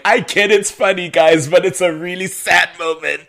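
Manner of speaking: high, loud, exaggerated protest; mock-distressed plea. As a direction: plead loudly with people who are laughing. Pleading with people laughing at scene.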